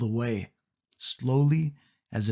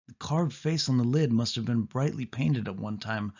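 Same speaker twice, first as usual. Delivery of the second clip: ordinary delivery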